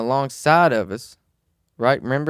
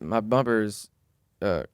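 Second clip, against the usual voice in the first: ordinary talk, nothing marked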